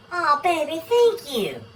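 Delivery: high, cooing thank-you; melting. Acted. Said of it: "The wife melts".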